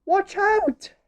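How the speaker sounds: very high squawked warning; high-pitched picture-book bird voice; bird's warning. A narrator's reading. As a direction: call out high like a little bird. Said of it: "but the bird says".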